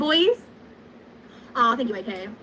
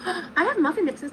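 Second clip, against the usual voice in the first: excited; 7 semitones higher, slower than usual. Real, not acted. Sudden idea with a gasp.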